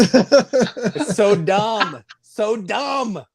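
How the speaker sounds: high, slow, exaggerated groan; drawn-out disgust. Real, not acted. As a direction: stretch out the disgust. Hating Happy Salmon.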